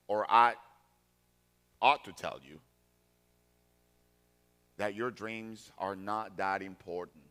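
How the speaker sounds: normal talking voice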